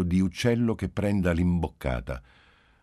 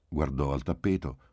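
ordinary delivery, nothing marked

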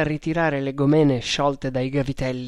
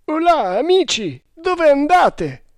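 hailing call; 13 semitones higher, 6 dB louder than usual. A narrator's reading. Quartermaster calling to friends on shore, hailing. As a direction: hail your friends loudly, voice pitched up.

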